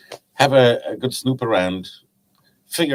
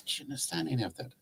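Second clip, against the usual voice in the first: fast, much softer aside to his wife; a quick hushed question out of earshot; quiet aside; 5 semitones higher, 17 dB quieter, much faster than usual. Retold or imitated. Husband asks his wife quietly, walking away from the fisherman. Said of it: "sort of quietly".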